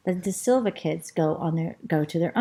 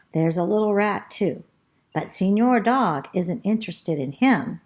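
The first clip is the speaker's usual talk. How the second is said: usual speaking voice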